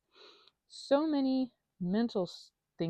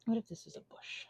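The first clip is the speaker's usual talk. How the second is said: whisper; 8 dB quieter than usual. Real, not acted. Whispering while painting.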